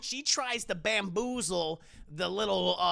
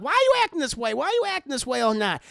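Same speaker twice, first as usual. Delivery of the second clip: higher and louder, an exasperated Madea; loud mock scold; 4 semitones higher, 6 dB louder, faster than usual. Madea scolding Aunt Bam, higher and louder. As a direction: demand it sharply.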